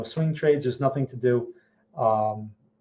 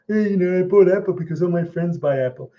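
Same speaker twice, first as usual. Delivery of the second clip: higher, louder dopey investor voice; mocking a mindless investor; 3 semitones higher, 4 dB louder than usual. Trader mocking herd investors, higher dopey voice. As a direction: play the clueless follower bragging.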